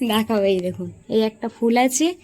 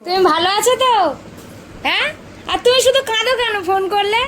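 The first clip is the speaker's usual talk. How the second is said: raised-voice questioning; 8 semitones higher, 4 dB louder than usual. Real, not acted. Daughter asks father why he cries on phone calls, raised-voice questioning. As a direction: speak up, pitched high and insistent. Asking tearful father how he is.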